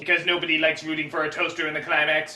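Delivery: deep, quiet, mechanical drone; low robot voice. Acted. Broken robot answering the kid, deep and quiet. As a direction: drop way down and go soft, like a dying machine.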